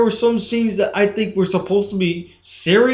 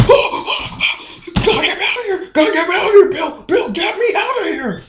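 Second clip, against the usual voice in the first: high-pitched mock panic; hammed-up scared; 11 semitones higher than usual. Mocking the movie's trapped friend begging Bill for help, hammed-up panic. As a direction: overact a frightened plea for rescue, pitched way up. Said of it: "he's trying to act like he's scared".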